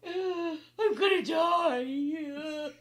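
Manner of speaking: high, loud, drawn-out hammed-up cry; mock panicked wail. Sister imitating Angel seeing ketchup, hammed-up wail. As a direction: imitate a kid wailing in terror. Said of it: "Angel's looking at all that ketchup going".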